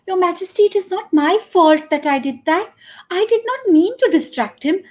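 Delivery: high, quick coy protest; flustered old dancer. Acted. Old dancer protests to the king, high and flustered. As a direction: deny it with a flustered flutter.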